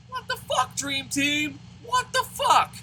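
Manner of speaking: high, strained cry of disbelief; betrayed fan crying out at his team; shocked whine. Real, not acted. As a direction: wail it at the team.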